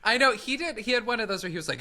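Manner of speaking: ordinary delivery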